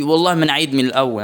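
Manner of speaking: ordinary talk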